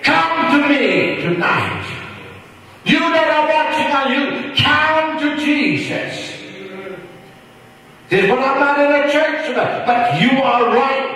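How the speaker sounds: high, fervent preaching, pushing hard; preaching at full force. Real, not acted. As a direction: preach it out to the congregation. Preaching call to viewers.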